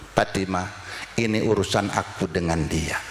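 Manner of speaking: the Prophet calmly quieting his daughter; gentle. Acted. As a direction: settle her gently.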